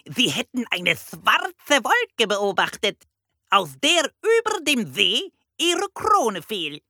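very high, croaky bird voice; piercing parrot squawk. A narrator's reading. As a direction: report excitedly like a parrot police chief. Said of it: "krächzte der Papagei".

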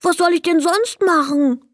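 a sad little goblin pleading; whiny, crestfallen tiny kobold. A narrator's reading. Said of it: "fragte er traurig".

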